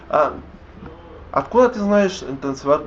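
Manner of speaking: ordinary delivery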